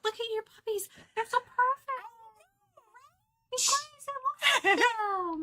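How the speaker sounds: high-pitched puppy voice. Real, not acted. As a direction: gush over puppies in a squeal. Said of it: "You got my little high-pitched puppy voice there".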